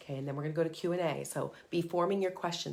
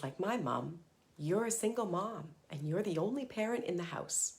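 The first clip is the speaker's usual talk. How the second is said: normal talking voice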